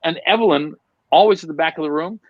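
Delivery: usual speaking voice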